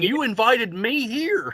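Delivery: slow, high-pitched protest; imitating a repeated, insistent objection; retold protest. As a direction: drag it out as an exasperated reminder. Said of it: "I had to keep saying".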